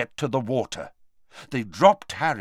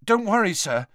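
anxious; 9 semitones higher than usual. A narrator's reading. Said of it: "anxious about Dumbledore's extreme pallor".

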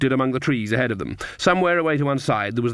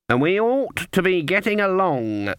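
raised, drawn-out character voice; old Granny witch; 6 semitones higher than usual. A narrator's reading. Granny hurrying the witches away, pointedly. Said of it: "said Granny Weatherwax".

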